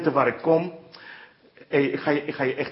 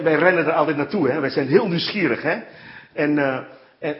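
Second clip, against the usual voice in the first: usual speaking voice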